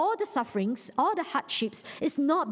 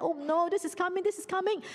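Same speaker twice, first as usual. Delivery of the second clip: higher-pitched, lightly acted dread; mock scared; 6 semitones higher than usual. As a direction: fret like frightened brothers, lightly acted. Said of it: "they were so scared".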